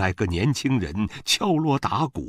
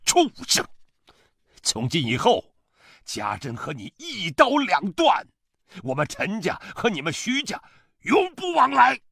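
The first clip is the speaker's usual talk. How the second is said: high, furious declaration; angry shout; 13 semitones higher than usual. A narrator's reading. Father-in-law disowns his son-in-law, shouting in fury. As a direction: shout it as a final break, climbing higher. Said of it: "又转过身来对我喊".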